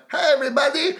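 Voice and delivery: hint of Russian accent, very high and loud; thick Russian accent. Little Elvis walks out greeting guests, high mock Russian accent. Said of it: "with a thick Russian accent".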